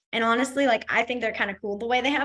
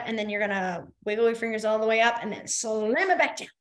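ordinary delivery

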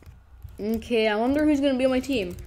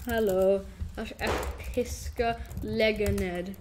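ordinary delivery

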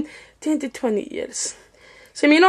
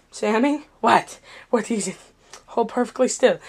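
tense hush; 4 semitones lower, 5 dB quieter than usual. Acted. Tense quiet warning.

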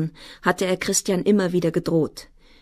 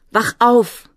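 higher, much louder cry; loud, urgent outcry; desperate shout; 5 semitones higher, 8 dB louder than usual. A narrator's reading. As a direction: scream to rouse someone.

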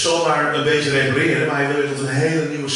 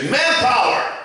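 high, loud defiant cry; defiant shout; 12 semitones higher, 6 dB louder, slower than usual. Acted. Mankind turning its back on God, defiant shout. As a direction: yell it like a proud rebel.

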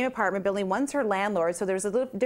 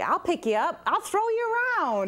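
higher boasting co-star voice; playful boast; 10 semitones higher than usual. Retold or imitated. Actress boasting she'll toss her co-star around, rising higher. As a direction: brag about throwing her around.

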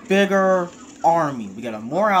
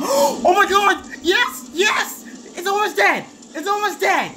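high, squealing disbelief; frantic excitement; 14 semitones higher than usual. Real, not acted. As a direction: squeal it, nearly in tears with excitement. Boss is nearly dead.

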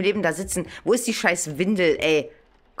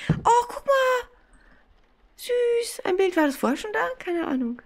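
cutesy squeal. Real, not acted. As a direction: coo over something adorable. Finds cute picture in game. (quietschend hohe Verzückung)

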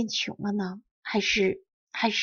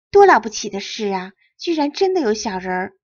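ordinary delivery, nothing marked

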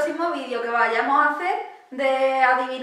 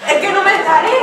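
higher, loud frustrated complaint; 4 semitones higher, 7 dB louder than usual. Real, not acted. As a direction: cry out in frustration.

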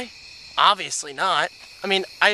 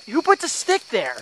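startled pain; 9 semitones higher than usual. Real, not acted. Walks into a stick.

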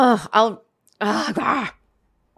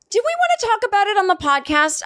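lightly acted question, pitched higher; retold own question; 11 semitones higher than usual. Podcaster replaying asking her cohost about covid, higher. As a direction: replay your earlier self, eager. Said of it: "I remember saying".

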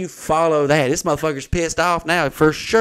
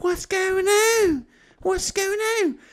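very high, drawn-out squeal; panicked old lady; much slower than usual. Retold or imitated. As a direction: mimic a bewildered lady in falsetto. Startled old lady answering the bailiff.

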